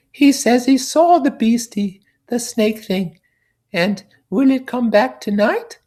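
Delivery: put-on piping kid relaying a small boy's fear; little boy's go-between. Piggy passing on the little boy's question in a high childlike voice.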